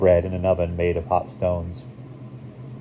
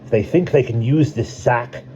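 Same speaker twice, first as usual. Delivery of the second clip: higher-pitched, forceful character voice; Tav's indignation; 6 semitones higher, 3 dB louder than usual. A narrator's reading. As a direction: object forcefully in a raised character voice. Tav's angry objection in council.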